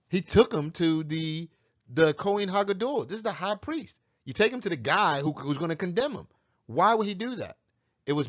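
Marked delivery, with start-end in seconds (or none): none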